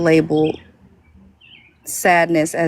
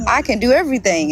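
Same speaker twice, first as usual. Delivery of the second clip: ordinary talk, nothing marked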